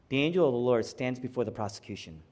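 ordinary talk

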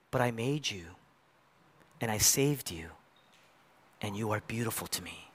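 soft, gentle, fading to near silence; tender reassurance. Retold or imitated. God to the ashamed listener, tender and hushed. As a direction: tell them they're beautiful, softly.

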